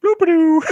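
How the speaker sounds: high-pitched "bloop-a-doo" sound effect; silly cartwheel noise. Retold or imitated. Host mocking Scorpion's cartwheel out from behind a tree, goofy high noise. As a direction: sing out a comic tumbling noise, high.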